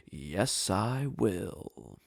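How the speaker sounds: put-on low, soft old man's reply; old mediator. Mediator standing up to volunteer, low and unhurried. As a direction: imitate a placid old man, low and drawn out. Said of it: "this old guy with a mustache".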